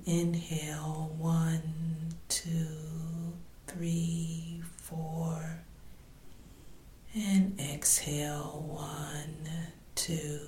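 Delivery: slow, soft meditation voice with drawn-out breath counts; guided meditation. Real, not acted. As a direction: guide the listener gently through breathing, letting the counts fade softly. Leads a body scan meditation.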